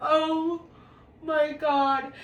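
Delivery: high, loud gasp then oh my God; shocked gasp. Real, not acted. Reliving the naked walk, loud shocked gasp. Reacting to being sent naked.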